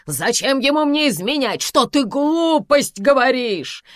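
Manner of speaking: high, loud outraged questions; indignant. A narrator's reading. Karina rejects talk of cheating, indignant. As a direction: protest hotly, voice leaping up. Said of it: "возмутилась Карина".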